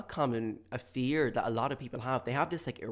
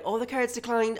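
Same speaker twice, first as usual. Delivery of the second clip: high, raised announcing voice; loud cashier; 12 semitones higher, 6 dB louder than usual. Acted. Cashier announcing a declined card, loud and high. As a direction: call it out for the whole shop.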